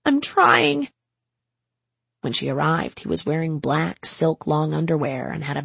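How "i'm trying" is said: put-on higher, louder young woman; Alice's voice. Alice answering Ezra from the street, higher strained voice.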